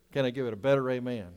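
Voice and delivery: normal talking voice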